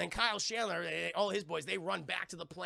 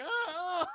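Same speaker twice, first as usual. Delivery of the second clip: high cry of being tossed about; imitated yelp; mock yelp; 12 semitones higher, 4 dB louder than usual. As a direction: yelp mid-air. Voicing a flung character.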